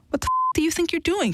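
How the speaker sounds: sharp, high demand. A narrator's reading. Wife, shoved against a pole, demanding an answer from her husband. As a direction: fling the question at him as she fights him off. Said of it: "she demanded".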